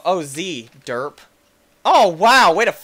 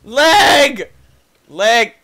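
high, loud disgusted yells; grossed out shrieks; 10 semitones higher, 9 dB louder, slower than usual. Real, not acted. Grossed out by game.

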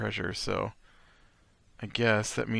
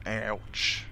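fumbling southern accent, trailing softer; attempted southern accent; 4 dB quieter than usual. Shown as a demonstration. Player rehearsing a character's warning, wobbly southern accent. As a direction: keep retrying the southern vowels, unsure. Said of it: "trying to remember what southern accent sounds like".

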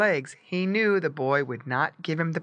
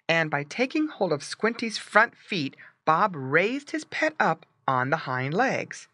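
plain reading voice

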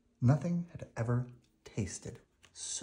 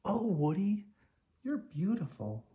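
put-on admiring children; 11 semitones higher than usual. The twins admiring Woody in bloom, high and delighted. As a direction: gush over how beautiful she is.

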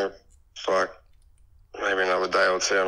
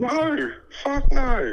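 shocked refusal; 12 semitones higher, much slower than usual. Real, not acted. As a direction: recoil in disbelief.

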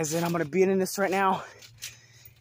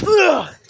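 loud grunt mid-jump; effort grunt; 9 semitones higher, 9 dB louder than usual. Real, not acted. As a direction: push out a sharp grunt.

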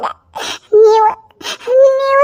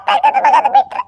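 pitched up into a crying wail; high wail; 10 semitones higher than usual. Acted. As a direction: wail it out in grief. Wailing over losing her womb. (ಎತ್ತರದ ಧ್ವನಿಯಲ್ಲಿ ಗೋಳಾಟ)

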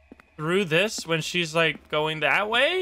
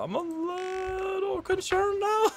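mock whimper; 14 semitones higher, 5 dB quieter, much slower than usual. Reacting to creepy game turn.

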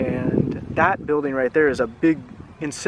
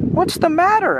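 put-on much higher, concerned girl asking; caring girl voice; 13 semitones higher, 3 dB louder than usual. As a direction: Mimi asks the crying dragon, high and concerned. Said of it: "asked Mimi".

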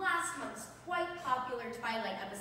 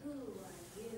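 soft, dreamy and lower, lost in her; awestruck, hushed wonder; entranced; 5 semitones lower, 9 dB quieter than usual. Shown as a demonstration. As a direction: breathe it out, spellbound. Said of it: "You need to act entranced by me".

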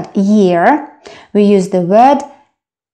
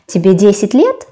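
"Тебе десять лет" with a quick rise at "лет"; yes-no question, "лет" pitched upward; question intonation; faster than usual. Shown as a demonstration. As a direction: ask it briskly, lifting the end on "лет". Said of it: "only intonation".

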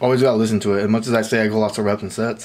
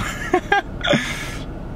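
high breathy laugh; 4 dB quieter than usual. Real, not acted. Numb-mouthed laugh after dentist.

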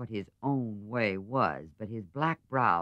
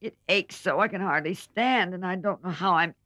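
in pain; 6 semitones higher than usual. Acted. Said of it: "rocking to and fro in pain".

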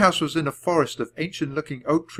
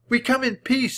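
calling; 12 semitones higher than usual. A narrator's reading. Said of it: "she called to the guard".

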